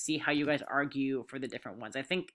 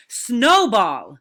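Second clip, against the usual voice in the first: loud, higher roar of the name; thundering roar; 5 semitones higher, 12 dB louder than usual. A narrator's reading. Napoleon roars the culprit's name to the animals. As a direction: bellow it out in fury. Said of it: "roared in a voice of thunder".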